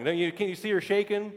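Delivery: normal talking voice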